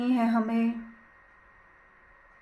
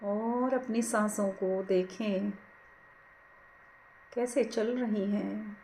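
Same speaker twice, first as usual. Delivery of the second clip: guided meditation; 4 dB quieter than usual. Acted. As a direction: ease them gently into watching the breath, unhurried. Leads a breath meditation. (धीमी, कोमल ध्यान कराने वाली आवाज़)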